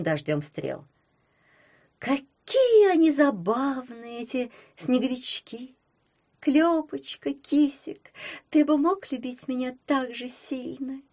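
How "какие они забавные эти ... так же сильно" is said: put-on high, slow dreamy girl voice. A narrator's reading. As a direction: sigh it out like a daydream. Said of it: "мечтательно спросила Милюля". (мечтательно)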